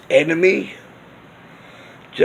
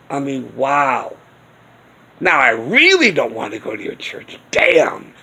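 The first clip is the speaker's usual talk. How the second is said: normal talking voice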